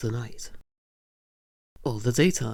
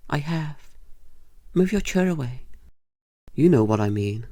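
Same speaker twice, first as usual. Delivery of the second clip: ordinary talk, nothing marked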